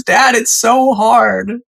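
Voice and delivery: high, drawn-out mock wail; hammed-up tearful complaint to dad; imitated bawling. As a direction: whine it like a son in tears. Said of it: "he starts bawling and he goes".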